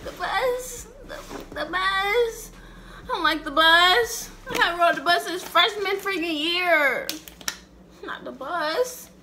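high, pleading whine about the bus; teen whining to dad; whiny protest. Retold or imitated. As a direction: complain like a teen. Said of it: "I said".

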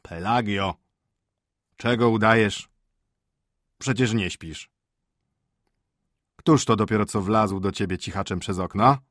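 accusing, emphatic questioning; suspicious, scolding husband; stern master. Acted. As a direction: interrogate a servant feigning sleep.

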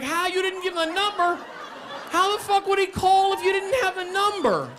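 very high, screechy rant; acted strained, high-pitched fury; exasperated outrage. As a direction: explode in disbelief, voice way up. Furious at roommate.

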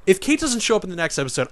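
ordinary talk, nothing marked

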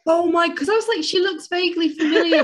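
loud high excited rush; excited disbelief. Real, not acted. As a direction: keep riding the surprise. Still reeling from recognition.

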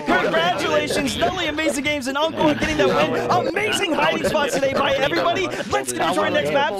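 high, excited announcer delivery; hyped congratulations. Real, not acted. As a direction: celebrate the winners like a match host. Announcing winners to the lobby.

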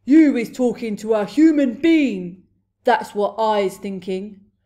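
very loud giant voice; booming accusation. A narrator's reading. Blood Bottler accusing the BFG, booming. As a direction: boom the accusation at full force. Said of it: "he boomed".

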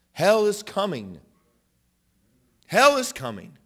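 high, very loud cry of warning; preacher's warning shout. Real, not acted. Preacher warning congregation of hell, shouted urgently. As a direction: thunder it out to the congregation.

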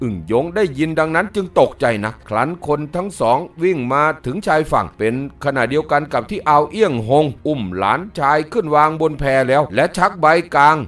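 plain reading voice

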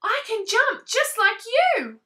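bursting with pride; excited calling. Acted. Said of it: "said Tadpole".

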